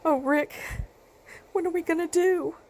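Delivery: high, helpless plea; anguished, high-pitched worry; distraught. Acted. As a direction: plead in despair to your husband. Distraught mother turns to husband.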